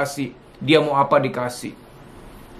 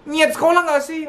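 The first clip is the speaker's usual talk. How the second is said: much higher, accusing mock scold; 12 semitones higher than usual. Parent scolding a forgetful child, high mock scold. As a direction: ham up a nagging parent. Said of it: "Yang gitu ada loh".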